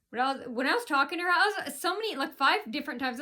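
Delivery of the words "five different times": ordinary talk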